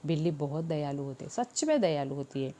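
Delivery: ordinary talk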